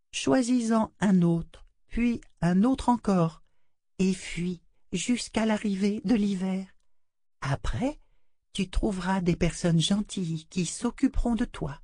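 put-on a doll consoling the child; tender doll voice. A narrator's reading. La poupée conseille. (voix de poupée tendre)

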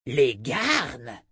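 dragon spitting the question back; spat scornfully. A narrator's reading. Dragon answering Richard's question about the garnes, spitting. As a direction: spit it out in dragon voice. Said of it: "cracha Écarlate".

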